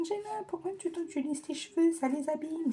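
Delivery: high, mocking scold; nagging relative. Vlogger mocking people who scold her about her curly hair. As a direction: mimic someone nagging about loose hair. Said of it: "On me dit".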